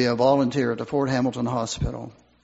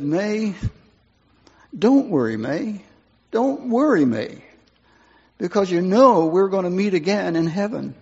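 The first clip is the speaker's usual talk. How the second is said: tender reassurance; 5 semitones higher, 3 dB louder than usual. Retold or imitated. Dying man comforting his wife.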